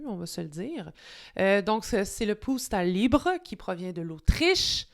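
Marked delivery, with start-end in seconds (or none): none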